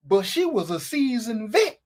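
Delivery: ordinary delivery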